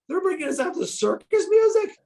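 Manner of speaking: can't believe what he's hearing; incredulous. Retold or imitated. American wrestler shocked by circus music at his entrance.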